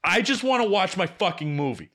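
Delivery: fed-up complaint about the interrupted movie; pissed off. Retold or imitated. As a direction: vent the fury at being interrupted. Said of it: "I was so pissed".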